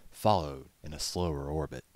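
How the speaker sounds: plain reading voice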